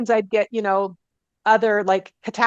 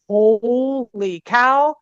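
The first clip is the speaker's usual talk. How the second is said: long drawn-out amazement; retold awe; much slower than usual. Healer reacting to client's changed eyes, awestruck and drawn out. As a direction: stretch it out slowly, stunned.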